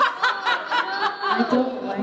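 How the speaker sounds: high, slow, laughing; laughing confession. Real, not acted. Voice actor confessing to a reader, laughing high. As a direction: admit it through laughter.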